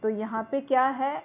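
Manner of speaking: ordinary delivery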